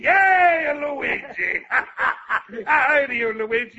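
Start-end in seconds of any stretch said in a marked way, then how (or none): 0.0-1.6 mock cheer. Teasing echo of the cheer.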